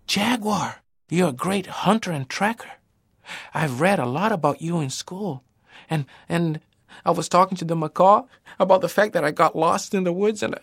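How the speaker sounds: plain reading voice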